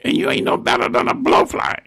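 high scolding granny voice; high-voiced scolding grandmother. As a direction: pitch it way up like the angry grandma. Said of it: "she said".

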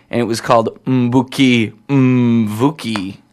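slow, "Mbuki Mvuki" with a hummed m before b and v; careful Mbuki Mvuki. Shown as a demonstration. DJ struggles with an album title on air. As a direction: sound out "Mbuki Mvuki", hum the m into the b. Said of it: "like the M B sound".

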